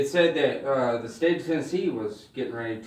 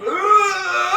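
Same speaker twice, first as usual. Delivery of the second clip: high, loud mock wail; hammed-up scream of horror; horrified scream; 7 dB louder than usual. Horrified reaction to fake mom.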